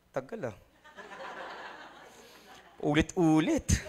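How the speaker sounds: low, soft bored grumble. Acted. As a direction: grumble under your breath, bored with the preaching. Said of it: "buring na buring ka na". (bagot na pabulong na reklamo)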